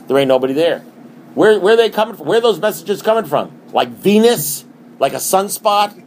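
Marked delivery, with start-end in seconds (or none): 1.3-6.0 higher, louder, mock-baffled; wide-eyed preacher asking where it comes from; rising incredulous questions. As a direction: play up the disbelief. Rhetorical building questions.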